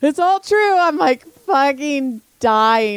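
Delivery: high, laughing through words. Real, not acted. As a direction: barely hold the laughter. Laughing while telling.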